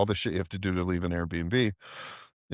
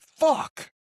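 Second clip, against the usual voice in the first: frustrated curse; 3 dB louder than usual. Retold or imitated. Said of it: "So I'm like".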